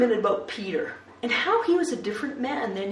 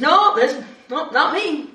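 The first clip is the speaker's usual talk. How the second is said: loud, higher hasty denial; flustered denial; 6 semitones higher, 7 dB louder than usual. Acted. Peter to the servant girl, loud flustered denial. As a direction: brush it off fast and loud.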